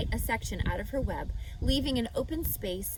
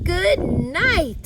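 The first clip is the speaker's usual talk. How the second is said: loud high scream; shrieking boy; 8 semitones higher, 12 dB louder than usual. A narrator's reading. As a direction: yell it out in disgust. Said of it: "screamed Avery".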